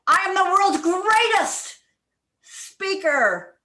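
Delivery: greatest hit high and loud; stress on greatest. Shown as a demonstration. Coach shows stress on greatest to the club. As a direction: punch greatest. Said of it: "one word at a time as if it is the most important".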